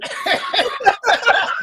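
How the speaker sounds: loud high laugh bursting out; burst of laughter. Real, not acted. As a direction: let a big laugh burst. Big laugh after story.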